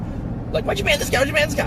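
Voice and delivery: very high mock pestering; clamoring chat mob. Chat repeating its demand about a ban, mock clamoring. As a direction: squawk it like an indignant mob.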